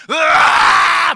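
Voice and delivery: 높고 길게 악쓰는 흉내; 잔소리에 폭발하듯 고함지르기; 맞받아치는 고함 흉내. Retold or imitated. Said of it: "뭐 이랬어, 안 했어?". (폭발하듯 악쓰는 소리)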